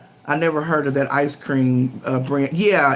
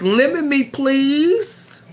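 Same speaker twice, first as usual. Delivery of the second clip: playful plea; 8 semitones higher, much slower than usual. Real, not acted. Playful plea for lemon ice cream.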